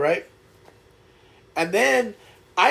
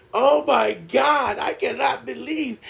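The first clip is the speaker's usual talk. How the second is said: higher, drawn-out mock outcry; imitated disbelief; 6 semitones higher than usual. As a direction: imitate mom exclaiming in utter disbelief. Mom's disbelief at his catch, retold.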